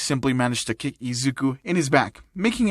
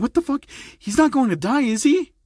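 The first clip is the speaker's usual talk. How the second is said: shocked disbelief; 10 semitones higher than usual. A narrator's reading. Shocked friend fears Izuku dies.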